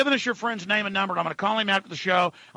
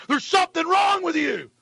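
angry scolding; 7 semitones higher than usual. Real, not acted. Scolding police listeners.

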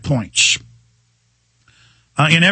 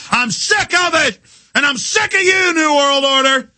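furious shout; 9 semitones higher than usual. Real, not acted. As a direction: hurl it straight at them. Host raging at enemies.

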